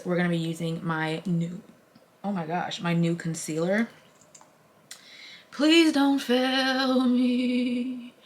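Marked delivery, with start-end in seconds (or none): 5.5-8.1 drawn-out high pleading to the makeup; slow hammed-up prayer to a product; mock plea. Real, not acted. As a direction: beg the concealer slowly, half joking. Begging her new concealer.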